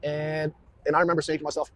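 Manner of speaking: ordinary talk, nothing marked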